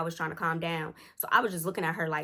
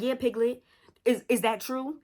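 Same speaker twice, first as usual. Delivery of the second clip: high, pointed irritated challenge; loaded question with mock-sweet lift; 5 semitones higher, 4 dB louder than usual. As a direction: challenge them in a pitched-up, irked tone. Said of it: "really irritated".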